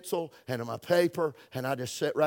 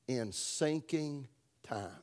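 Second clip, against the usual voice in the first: whispered title; 8 dB quieter, much slower than usual. Real, not acted. Announcing sermon title.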